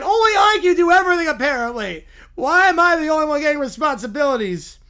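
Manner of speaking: loud, high-pitched indignant complaint; whiny Little Bear. Acted. Little bear objecting to Smokey's speeches, loud whine. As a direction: protest like an aggrieved cub.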